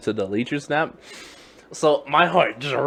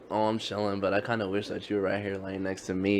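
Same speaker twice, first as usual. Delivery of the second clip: softer, lower, mock-seductive invite; flirty friend; 5 semitones lower, 11 dB quieter than usual. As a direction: purr it like a sleepy come-on. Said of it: "and he's like".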